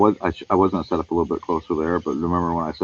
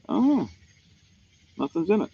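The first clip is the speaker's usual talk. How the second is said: high surprise; 12 semitones higher than usual. Real, not acted. Checks the empty coffee mug.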